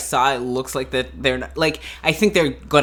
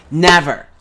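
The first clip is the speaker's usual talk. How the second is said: emphatic loud never; 2 semitones higher, 13 dB louder than usual. Real, not acted. Emphatic denial.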